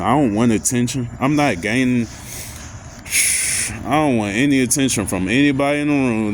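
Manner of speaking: ordinary talk, nothing marked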